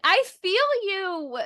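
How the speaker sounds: high, drawn-out outburst of fellow feeling; emphatic empathy. Retold or imitated. Podcaster reacting to watching Jo, emphatic empathy. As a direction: stretch it out high, heartfelt.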